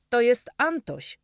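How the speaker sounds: loud call across the stable. Acted. Dominik calls to his friend across the stable, loud call. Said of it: "krzyknął Dominik".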